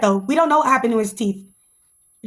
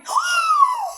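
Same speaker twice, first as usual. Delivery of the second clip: a huge high mock gasp. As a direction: gasp in fake shock. Reaction to shocking reveal.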